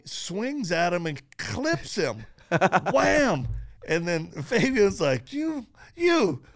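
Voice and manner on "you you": stunned stammer. Retold or imitated. Fabian stunned after being hit.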